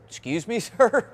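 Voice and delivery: high, startled question with a laugh; can't believe what he just heard; surprised disbelief. Retold or imitated. Recruit asking the officer, stunned and laughing.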